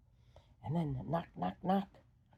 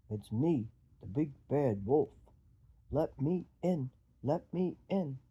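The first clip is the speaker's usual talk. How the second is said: low, loud wolf voice; big bad wolf; 4 semitones lower, 6 dB louder than usual. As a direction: growl it low and demanding. Wolf at the door.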